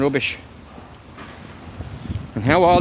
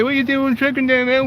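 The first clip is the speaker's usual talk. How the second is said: higher, mocking voice of the critics; 8 semitones higher than usual. Mimicking critics who object to his filming, higher and mocking. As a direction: parody the people who complain about him. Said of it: "people will say".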